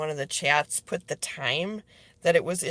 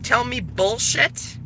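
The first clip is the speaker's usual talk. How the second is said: furious warning; 11 semitones lower, 6 dB louder than usual. Real, not acted. Threatening anyone who lies to her, loud and furious. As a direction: tell the liars they will pay.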